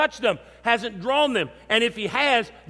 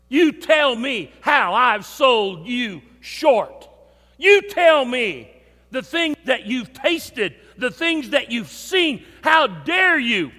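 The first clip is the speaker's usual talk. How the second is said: louder, slow, stern rebuke; slow stern divine reprimand; voice of God, stern; 2 semitones higher, 4 dB louder, slower than usual. Retold or imitated. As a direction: thunder each word slowly at him.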